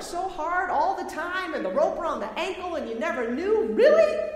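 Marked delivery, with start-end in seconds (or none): 3.7-4.3 high, loud, disbelieving question; loud rhetorical "you can't be serious"; incredulous challenge. Acted. Acted disbelief at going back.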